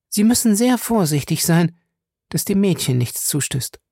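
earnest warning in a high woman's voice; suddenly serious. Acted. Fay warns Featherstone about the girl, suddenly serious. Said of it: "fuhr sie plötzlich ernst fort".

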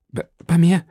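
put-on a moved young man stammering on his knees. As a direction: stumble over the first words, overcome. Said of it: "stammelte er".

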